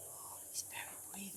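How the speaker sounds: soft unvoiced whisper. A narrator's reading. Narrator whispers as the mouse sneaks onto the crocodile. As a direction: whisper it so nobody wakes the crocodile.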